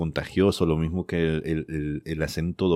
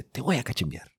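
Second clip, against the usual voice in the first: lightly voiced little kid, higher pitch; imitated five-year-old; 7 semitones higher, 4 dB quieter than usual. Parent quoting the five-year-old child, lightly imitated kid voice. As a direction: mimic a small child's threat, pitched up. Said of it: "el más pequeño tiene cinco años".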